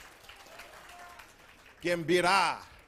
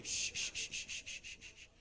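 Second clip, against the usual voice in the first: soft, voiceless string of shushes; repeated shushing; 14 dB quieter than usual. Real, not acted. Host quieting the crowd, softly shushing. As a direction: hush the room with quick repeated shh sounds.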